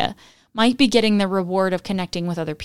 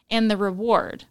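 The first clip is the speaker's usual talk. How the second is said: normal talking voice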